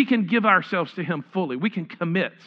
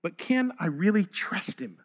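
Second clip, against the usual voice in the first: quiet, hesitant voice of someone who doubts; doubting worrier; 4 dB quieter than usual. Retold or imitated. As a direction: ask it softly, as if afraid to trust. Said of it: "goes something like this".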